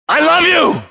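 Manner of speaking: high, gushing I love you; loving tone. Shown as a demonstration. Teacher shows a child a loving tone. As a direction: say it sweetly to a small child. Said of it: "how you say it".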